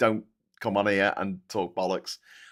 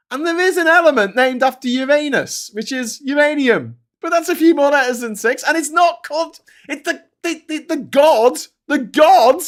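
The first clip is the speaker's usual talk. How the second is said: much higher and louder, outraged disbelief; incredulous rant; 7 dB louder than usual. Real, not acted. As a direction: rant like you can't believe it. Ranting about chatbot's wrong list.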